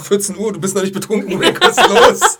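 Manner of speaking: laughing mock voice. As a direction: ham up the drunk doctor while cracking up. Imitating drunk doctor Pete.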